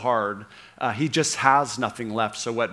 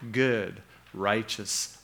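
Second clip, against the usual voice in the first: ordinary talk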